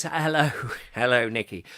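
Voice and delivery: ordinary talk, nothing marked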